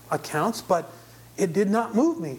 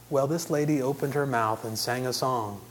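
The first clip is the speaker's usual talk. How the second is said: ordinary talk, nothing marked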